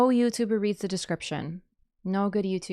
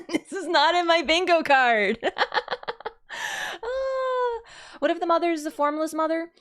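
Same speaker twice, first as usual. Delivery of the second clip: high, breathless laugh through the words; cracking up in disbelief; laughing excitedly; 10 semitones higher, 4 dB louder than usual. Real, not acted. Gamer reacting to a boss reveal, laughing and excited.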